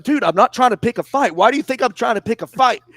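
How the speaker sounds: mock flustered kid; defensive trainee. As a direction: protest too much, rattled.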